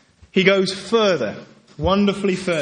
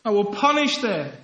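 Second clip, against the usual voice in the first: a hint of an outraged God; God speaking; 7 semitones higher than usual. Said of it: "And God said".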